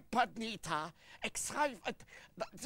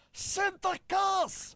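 "Sinterklaas" yelled much higher and very slowly; shouted correction; 10 semitones higher, 6 dB louder, much slower than usual. Shown as a demonstration. Puppet yelling the name at his deaf partner, shouted. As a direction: bellow "Sinterklaas" with every syllable drawn out. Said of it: "niet Sinterklaas".

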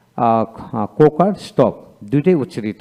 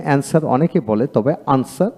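ordinary talk

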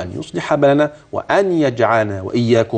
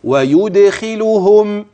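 slow, drawn-out tajweed reading of ويدخلهم; Quranic recitation demo; 6 semitones higher, 4 dB louder, much slower than usual. Shown as a demonstration. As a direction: recite ويدخلهم slowly, every letter clear. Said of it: "اسمع".

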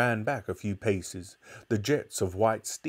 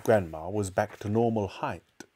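ordinary delivery

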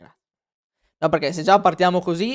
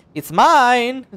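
higher and louder, pitch pushed up American-style; mock American tone; 7 semitones higher, 6 dB louder than usual. Streamer mocking how the game's Americans talk, higher and louder. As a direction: mimic how Americans raise their tone. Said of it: "gli americani quando parlano fanno così".

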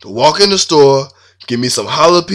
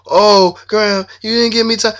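put-on whining girlfriend; 7 semitones higher than usual. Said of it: "she went to whining and junk".

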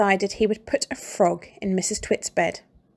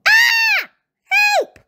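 screaming; 8 dB louder than usual. A narrator's reading. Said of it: "screamed Missus Twit".

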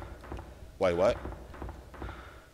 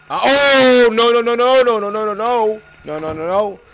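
loud, high run of no's; panicked wail, easing slightly at the end; real panic; 8 semitones higher, 9 dB louder than usual. Real, not acted. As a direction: cry out as disaster hits. Panics as game goes wrong.

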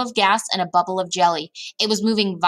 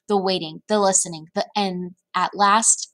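ordinary delivery, nothing marked

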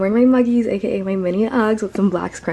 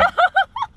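high laugh. Real, not acted. Reaction to the cashier.

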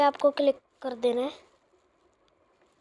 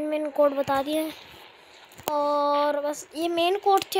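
ordinary talk, nothing marked

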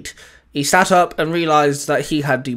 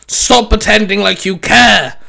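loud, higher, slow angry shout; roared; 5 semitones higher, 7 dB louder than usual. Acted. Danzo rejects his old friend's concern, roared. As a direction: hurl it out as an angry roar. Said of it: "Danzo roared".

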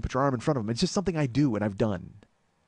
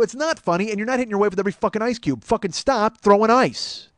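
angry rant; 7 semitones higher, 6 dB louder than usual. Retold or imitated. Bouncer scolding the ice thrower, loud and heated. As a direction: build to a fed-up shout. Escalating argument.

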